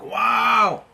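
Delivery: high, loud burst of wonder; awed wow. Pretend. Describing a spiritual high, an awed exclamation. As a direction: gasp it out in amazement. Awe at a rare experience.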